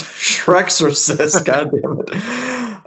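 slow, giggling self-scold; laughing at own flub. Real, not acted. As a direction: laugh at the slip of Shreksercist. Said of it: "I was about to say Shark Exorcist".

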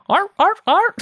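barking like a seal. Retold or imitated. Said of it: "impression of a seal".

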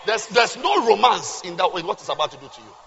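usual speaking voice

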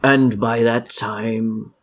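slow, ominous delivery; lightly voiced game villain; grave in-character reply. Game master answers as the villain, understated grave warning.

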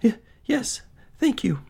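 high, stammering thanks; frightened, halting reply; faint with fear. A narrator's reading. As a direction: answer the monster timidly, pitched high. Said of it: "she nearly fainted with fear".

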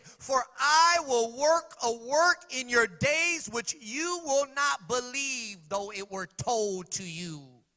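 loud, high, slow proclamation; preacher voicing God, slow and loud; God's voice reading.

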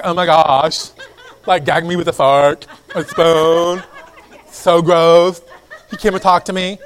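loud, mock-grossed-out girl slang; faddish girl talk. Girl mock-horrified that he talked to her, faddish girl talk. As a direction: ham up the disgusted girl, laughing edge. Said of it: "Talking that way was very faddish".

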